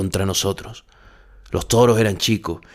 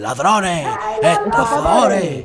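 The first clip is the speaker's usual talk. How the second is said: furious yells climbing much higher and louder; angry shouting; 10 semitones higher, 4 dB louder, much slower than usual. Acted. Angry fans yelling at the bullfighters, furious shout. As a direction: hurl the insults like an enraged crowd. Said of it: "nos gritaban".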